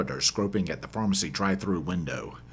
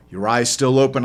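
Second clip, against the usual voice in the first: louder, raised question; gruff question; 4 semitones higher, 8 dB louder than usual. Acted. Narrator checks on his stowaway, loud gruff question. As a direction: call it back over the noise. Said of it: "I ask my stowaway".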